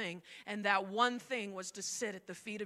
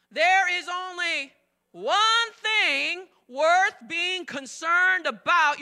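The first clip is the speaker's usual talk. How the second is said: loud, very slow, stress on one; emphatic reading; 6 semitones higher, 12 dB louder, much slower than usual. Shown as a demonstration. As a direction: read it out slowly, hitting one. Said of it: "read verse forty-two".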